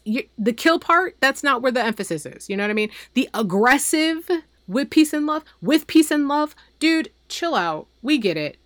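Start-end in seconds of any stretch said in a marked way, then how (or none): 3.1-6.6 "peace" hit higher and harder in "with peace and love"; stress on peace. Shown as a demonstration. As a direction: lean on the word "peace", mocking the catchphrase. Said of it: "putting emphasis on the wrong word".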